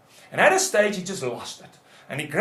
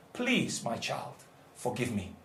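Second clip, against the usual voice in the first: tender plea; 7 semitones lower, 10 dB quieter than usual. Shown as a demonstration. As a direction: drop to a soft, low entreaty. Models father's plea to child.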